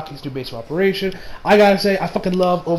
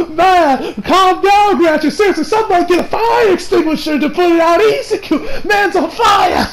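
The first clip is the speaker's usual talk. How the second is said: high, loud helpless laughter; howling with laughter at the joke; laughing hard; 9 semitones higher, 4 dB louder than usual. Real, not acted. Cracking up at the burn.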